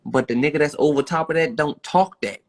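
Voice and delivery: ordinary delivery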